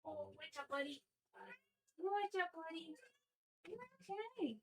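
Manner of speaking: high, soft coo. Real, not acted. Owner checking on her pet softly.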